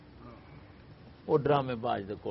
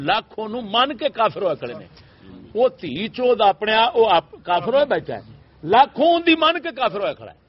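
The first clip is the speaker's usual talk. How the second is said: loud, high-pitched outburst of outrage; angry rant; 10 semitones higher, 9 dB louder than usual. Real, not acted. Cleric denouncing a man who misleads lakhs, furious. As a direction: shout the denunciation at full pitch.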